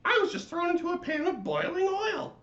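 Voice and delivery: very high, loud squeaky wail; crying latke. Acted. Latke complaining to the lights, high wailing squeak. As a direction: squeal out your pain. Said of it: "the latke cried in reply".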